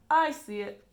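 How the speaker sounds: loud, eager exclamation; excited cry. A narrator's reading. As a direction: call it out with delight. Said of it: "cried Mister Wonka".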